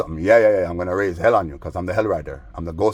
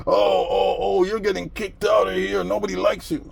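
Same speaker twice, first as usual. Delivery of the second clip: much higher sneering voice; mock shrill taunt; 12 semitones higher than usual. Mocking the neighbor saying he'll be kicked out, high sneer.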